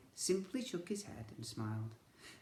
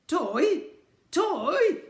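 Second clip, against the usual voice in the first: very high, loud spluttering toy; 14 dB louder than usual. Acted. Edmund outraged at the boy's question, spluttering. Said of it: "spluttered Edmund".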